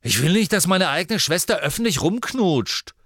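loud, high indignant rebuke; angry scolding. Acted. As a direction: scold her like a fuming big brother. Angry brother scolds sister.

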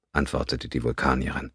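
plain reading voice

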